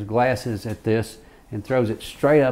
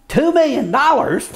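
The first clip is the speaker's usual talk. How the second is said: high, loud, put-on judge voice; imitating the judge; 6 dB louder than usual. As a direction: scoff at the sum like the judge. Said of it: "and he says".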